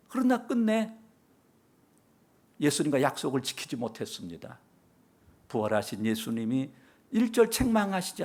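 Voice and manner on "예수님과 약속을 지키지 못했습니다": ordinary talk, nothing marked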